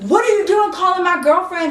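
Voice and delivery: high, heated confrontation; acted outraged demand; cussing her out. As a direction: imitate an angry boyfriend laying into someone. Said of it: "started cussing her out".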